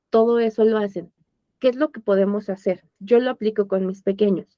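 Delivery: normal talking voice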